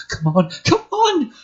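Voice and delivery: high, desperate urging; frantic urging. Acted. Casey fumbling with her suit, frantic. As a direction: hurry yourself in panic. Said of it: "fumbling at the catches".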